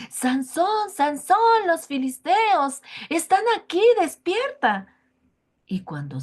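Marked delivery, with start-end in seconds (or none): 0.1-4.9 high, loud cry of alarm; shouting alarm. A narrator's reading. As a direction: yell to wake him, sounding frantic. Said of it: "Dalila empieza a gritar".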